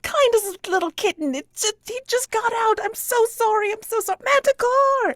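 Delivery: very high, loud frantic pleading; pleading Tuttle. Acted. Tuttle begging the crowd not to hurt his manticore, high frantic plea. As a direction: beg them to spare his pet. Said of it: "And he's like".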